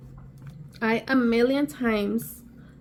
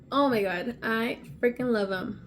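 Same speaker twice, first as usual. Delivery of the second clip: ordinary talk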